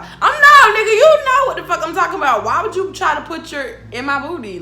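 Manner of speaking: loud, high, rushing protest; retold angry outburst. Storyteller snapping back at the man's denial, loud angry outburst. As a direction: explode in reenacted indignation. Acted quarrel with the man.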